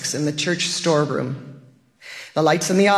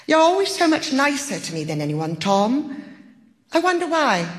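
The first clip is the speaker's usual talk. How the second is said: gentle; 7 semitones higher than usual. A narrator's reading. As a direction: ask tenderly, almost coaxing. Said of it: "said in a gentle voice".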